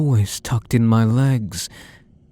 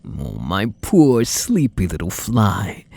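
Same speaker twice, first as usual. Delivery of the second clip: ordinary talk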